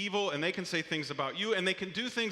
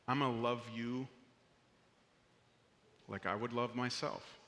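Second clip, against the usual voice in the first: tender promise; 7 semitones lower, 5 dB quieter than usual. Retold or imitated. Loving words offered to neighbor.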